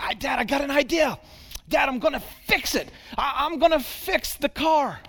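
put-on high, quick, eager kid voice; a six-year-old rushing to make amends; eager little boy. Son voiced in the story.

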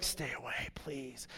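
very soft, breath-only plea; hushed pleading. Retold or imitated. Sub dreading the children's return, hushed pleading. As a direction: beg under your breath.

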